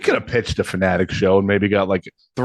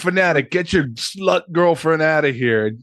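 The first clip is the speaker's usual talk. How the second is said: mock drunk dad yelling; 6 semitones higher than usual. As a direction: spit it out like a drunk dad throwing them out.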